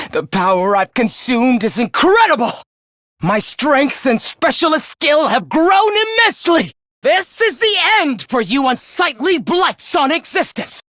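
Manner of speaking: exultant villain shout, climbing high; power-mad shouting. Acted. Villain boasting of his power to doomed enemies, maniacal shouting. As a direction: shout in mounting, maniacal triumph.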